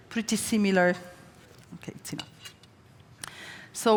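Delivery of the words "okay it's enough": muttered under her breath while plating; hushed, almost voiceless aside; whispered aside. Real, not acted. Chef plating, muttering softly to herself.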